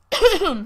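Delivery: loud acted throat-clear. Herb recovering after a husky reply, clearing throat. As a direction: clear your throat sharply to recover composure. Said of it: "he clears his throat".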